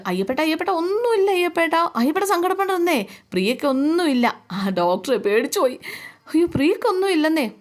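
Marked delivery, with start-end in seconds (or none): 0.1-4.4 much higher, giddy reassurance; manic happy. Retold or imitated. Brother telling Ayyappan not to worry, manic glee. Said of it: "സന്തോഷത്തോടെ ചിരിച്ചുകൊണ്ടാണ്". 6.3-7.5 high, giddy protest; manic denial. Retold or imitated. Brother insisting his sister is fine, giddy.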